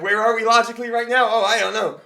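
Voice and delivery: high, loud comic floundering; mock panic in stumbling, high-pitched bursts; flustered panic. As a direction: act out losing the thread, loudly and high. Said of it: "our flow is getting like".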